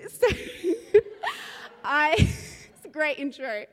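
amused, laughing through the words. Real, not acted. Laughing at her own story as she introduces the topic. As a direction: chuckle as you say it.

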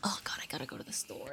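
whispered inner dread; a whispered oh God. Retold or imitated. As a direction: breathe it out like a nervous thought. Dreading a trip to the store.

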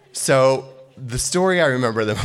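ordinary delivery

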